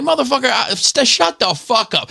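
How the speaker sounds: high, strained mock yell without full volume; worked-up road-rage comeback; retold angry retort. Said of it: "I just started yelling".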